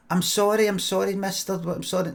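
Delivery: a hint of a child hurrying to say sorry; apologetic small boy. Boy at the window to a stranger, mildly pleading apology.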